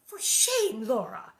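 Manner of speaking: high, loud reproach; scolding mother. A narrator's reading. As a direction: scold the child, pitch jumping up. Ma scolds Laura in the story.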